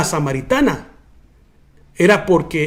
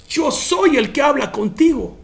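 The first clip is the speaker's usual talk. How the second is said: higher, amazed restatement; awed repetition; 6 semitones higher than usual. Retold or imitated. Preacher repeating Jesus's words to the congregation in awe, higher. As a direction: lift it up in wonder. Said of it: "Oh my God, hermano".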